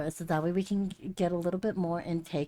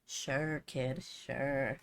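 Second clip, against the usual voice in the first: put-on dry sarcastic "sure, kid"; 5 semitones lower, 9 dB quieter, much slower than usual. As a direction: humor the kid, unconvinced. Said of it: "they're like".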